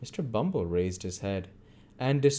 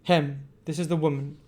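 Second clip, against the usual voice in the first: cautious Bumble; 7 semitones higher, 4 dB louder than usual. A narrator's reading.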